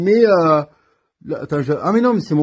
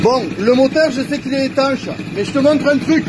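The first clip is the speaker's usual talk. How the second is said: higher voice pushed over the motor; raised voice over engine; 7 semitones higher than usual. Real, not acted. Mechanic showing viewers the engine, raised voice over noise.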